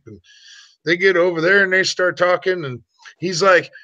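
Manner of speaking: normal talking voice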